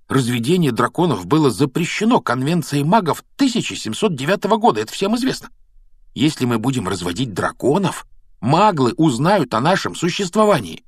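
high, animated explaining with swooping pitch; lightly voiced youngster warning earnestly; know-it-all young student. A narrator's reading. Narrator voices the lecturing friend.

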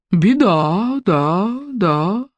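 sullen echo fading away. Acted. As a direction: repeat it grimly, dying off. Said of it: "угрюмо повторило эхо".

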